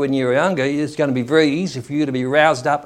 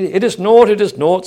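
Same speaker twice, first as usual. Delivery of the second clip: higher, louder dismissive buyer; haggling buyer; 6 semitones higher, 7 dB louder than usual. Acted. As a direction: play the buyer talking the goods down, louder. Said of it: "saith the buyer".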